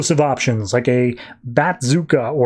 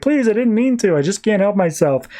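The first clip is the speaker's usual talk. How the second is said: mock pleading; 6 semitones higher than usual. Accused begs the council for mercy, mock pleading. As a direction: plead your case in a playful whine.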